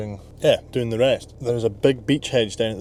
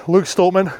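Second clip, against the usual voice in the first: calling out; 7 semitones higher, 7 dB louder than usual. Real, not acted. Calling out a strongman.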